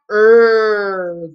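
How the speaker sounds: "Er" as one long, held R; R sound. Shown as a demonstration. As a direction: draw out "Er" as errr. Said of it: "what's the R sound".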